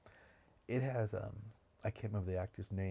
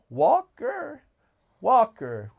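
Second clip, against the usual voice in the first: put-on much higher, much louder boyish call; cheeky boy shouting; 12 semitones higher, 16 dB louder, much slower than usual. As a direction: yell it like a street kid who doesn't believe you. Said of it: "And the boy says".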